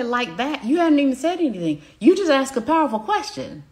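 high, fast, amazed client voice; excited client. Retold or imitated. Client reacting to a powerful question, high and amazed. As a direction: play the client thrilled at the question. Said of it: "They say".

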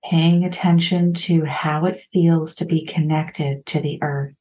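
calm meditation guide with long pauses; measured, even meditation voice, lingering between phrases; guided meditation. Real, not acted. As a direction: ease listeners through it, phrase by unhurried phrase.

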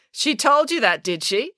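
high mocking question; sarcastic. A narrator's reading. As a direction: needle her with sarcasm. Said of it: "asked April sarcastically".

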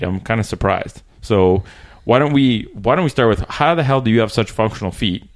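usual speaking voice

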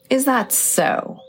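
high, slow and sly. Acted. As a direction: tease her slyly. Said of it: "with a sly smile".

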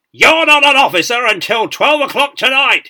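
huge, high parade-ground roar; thunderous drill-square dressing-down; full bellow. A narrator's reading. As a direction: bellow like an outraged sergeant major. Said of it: "he bellowed".

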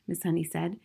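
plain reading voice

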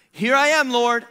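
high, loud mock-willing answer; eager volunteering. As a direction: sing out as if ready to serve. Jonah's hearty answer before refusing.

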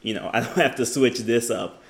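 ordinary talk, nothing marked

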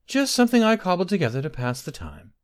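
put-on high-pitched servant girl making excuses; elf maiden. A narrator's reading. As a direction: play it high and innocent. Elf maiden excusing herself to master.

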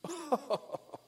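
high, mocking snob titter; condescending laugh. Rich kids looking down at his cabin trip, snooty laugh. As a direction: give a high, fake society laugh. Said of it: "they'd do one of these".

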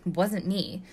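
ordinary delivery

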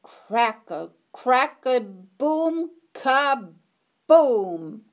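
loud crackle building to a boom; crackling thunder. A narrator's reading. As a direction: make the thunder crackle then explode. Thunder sound effect in story.

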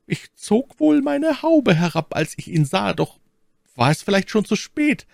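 very high, worried maid's voice; besorgte Ketty. Acted. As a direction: confess the worry in a high voice. (besorgte, sehr hohe Mädchenstimme)

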